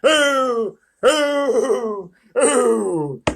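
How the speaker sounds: a fawn howling with grief; sobbing fawn. A narrator's reading. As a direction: wail out each ooh like a weeping fawn. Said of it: "sobbed Mister Tumnus".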